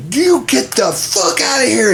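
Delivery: high, slow furious yell; Mama Bear fury. Retold or imitated. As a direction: chase the golfer off, mama bear. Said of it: "she turned into Mama Bear".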